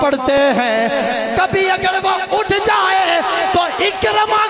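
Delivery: high, loud preaching at full force; a preacher carried away by the story, calling out loud; fiery preaching. Acted. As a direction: preach it with rising passion. Preaching climax, story voices.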